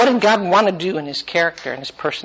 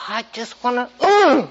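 mock frustrated parent; 4 semitones higher than usual. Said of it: "I go".

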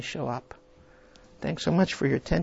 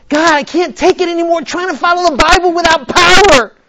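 high, loud pleading cry; desperate prayer; 12 semitones higher, 13 dB louder than usual. Retold or imitated. Preacher recalling begging God, high and loud. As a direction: cry out to God at the end of your rope. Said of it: "It's like me".

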